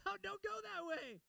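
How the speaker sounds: high, loud shouted warning; God shouting a warning down the road. Retold or imitated. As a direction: yell to stop them from taking the road. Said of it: "yelling, screaming, shouting".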